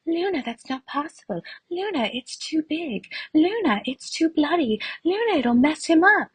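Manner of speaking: high, whiny mock of the people telling Luna no; mocking the doubters. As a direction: parrot the naysayers in a silly high voice. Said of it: "everybody was telling me".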